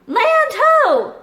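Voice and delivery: high, loud call from the lookout; cry of land ho. Acted. Jim spotting the island, calling out high and loud. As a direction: shout it out to the ship. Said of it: "cried Jim".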